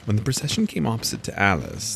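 usual speaking voice

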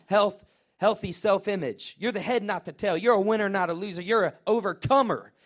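ordinary talk

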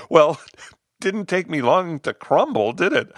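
high-pitched, laughing admission; amused at himself, laughing; bursting out laughing. A narrator's reading. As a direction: talk through an acted burst of laughter. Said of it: "He finally burst out laughing".

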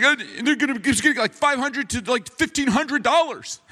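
high mock lament, slowing on the ending; distraught wife. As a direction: imitate an upset wife, pitched right up. Retold wife laments lost money.

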